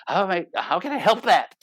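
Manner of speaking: high, exasperated protest; frustrated. Retold or imitated. Husband defends his tone to hurt wife, frustrated. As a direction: protest helplessly with mounting frustration. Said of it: "I'm frustrated. So you're gonna hear frustration".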